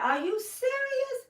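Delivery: high, slow, stunned disbelief; shocked disbelief. Retold or imitated. Hearing the channel was shut down, asks in high shocked disbelief. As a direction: gasp it out in disbelief. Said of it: "I said".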